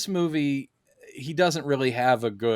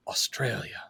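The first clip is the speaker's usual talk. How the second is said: whispered Australia; 3 semitones lower, 7 dB quieter than usual. Retold or imitated. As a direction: breathe it out unvoiced. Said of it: "And he just goes".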